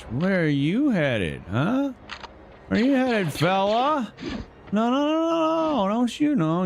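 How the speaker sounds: high, mocking taunts; taunting chase. Real, not acted. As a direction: jeer while chasing someone down. Chasing enemy, taunting.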